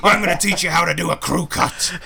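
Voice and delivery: barking drill sergeant, far off his own voice. Acted. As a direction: bark it like a boot camp drill instructor. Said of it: "Drill sergeant comes out".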